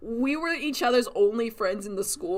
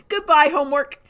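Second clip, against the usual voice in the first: gleeful farewell; 7 semitones higher, 9 dB louder than usual. Retold or imitated. Brain ditching homework for story.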